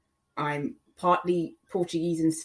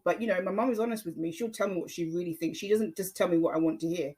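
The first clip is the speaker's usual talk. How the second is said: usual speaking voice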